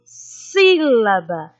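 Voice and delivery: sílaba slowly, with a long, strong first sí; lengthened stressed syllable. Shown as a demonstration. Teacher showing the antepenultimate is stronger, lingering on sí in sílaba. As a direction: hold the opening syllable of sílaba. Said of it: "a sílaba mais forte a gente se demora".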